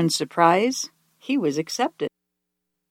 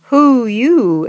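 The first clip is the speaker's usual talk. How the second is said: higher, louder little question; owl asking; 6 semitones higher, 6 dB louder than usual. A narrator's reading. Small owl questioning the hidden dragon. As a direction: ask back nervously. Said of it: "asked Owl".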